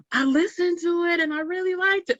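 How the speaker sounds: excited high-pitched gush after a gasp; gushing retold reaction. Bar customer reporting back on a recommendation, gushing.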